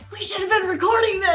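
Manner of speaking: high-pitched, giddy regret; retold squeal of amused excitement; thrilled, as if missing a great show; excited exclamation. Retold delighted regret while watching.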